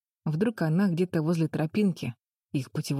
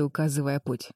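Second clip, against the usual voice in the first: plain reading voice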